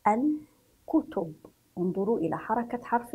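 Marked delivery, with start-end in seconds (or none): 0.1-1.3 "الكتب" slowly as al, pause, kutub; split into syllables. Shown as a demonstration. Teacher introduces the word to pupils, "الكتب" slowly split after al. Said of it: "لذلك سنضم الحرف".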